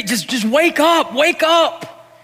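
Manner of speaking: put-on high pleading child's echo. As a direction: plead like the boy at the bedside. Pleading imitation of the cry.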